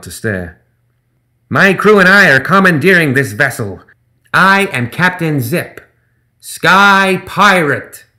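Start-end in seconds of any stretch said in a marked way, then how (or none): 1.5-8.0 high, loud, very slow gremlin boast stretching Sky Pirate; grand emphasis on Sky Pirate. Acted. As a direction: drag out "Sky Pirate" for effect. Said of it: "put a lot of emphasis on the Sky Pirate part".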